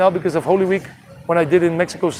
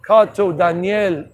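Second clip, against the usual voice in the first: ordinary talk, nothing marked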